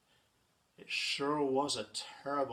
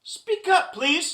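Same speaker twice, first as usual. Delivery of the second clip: high, loud request; raised storybook elephant voice; calling out; 13 semitones higher, 13 dB louder, slower than usual. Acted. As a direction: call down to someone tiny. Horton calls to faint voice.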